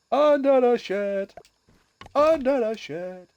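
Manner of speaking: high, slow Jamaican accent; drawn-out Jamaican accent line. Shown as a demonstration. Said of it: "say it in a Jamaican accent".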